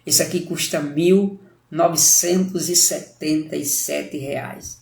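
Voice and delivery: slow, deliberate delivery of each number; drawn-out price reveal. Retold or imitated. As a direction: spell out the price slowly, letting it sink in. Clerk reveals the shocking price.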